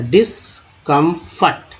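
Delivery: डिस्कम्फर्ट said slowly, dis set apart; teacher modelling डिस्कम्फर्ट slowly; slow sounding out. Shown as a demonstration. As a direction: stretch out डिस्कम्फर्ट so the dis part shows. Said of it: "डीआईएस डिस होता था".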